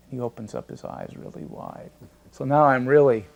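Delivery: normal talking voice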